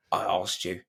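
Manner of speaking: a restrained East End reprimand; Michael Caine impression. Caine scolding the crew over the blown-up van, quietly. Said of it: "just comes in with".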